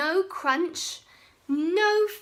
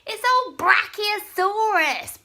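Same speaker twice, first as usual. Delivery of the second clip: high, loud mocking laugh; 9 semitones higher, 10 dB louder than usual. Bonehead laughing at his scared friends, high and loud. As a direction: crow with glee at the trick. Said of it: "Bonehead laughed".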